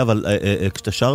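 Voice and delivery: ordinary talk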